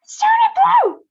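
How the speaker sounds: piercing high loud scream. A narrator's reading. Mother screams at daughter turning blue, high and loud. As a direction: scream it in horror. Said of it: "screamed Missus Beauregard".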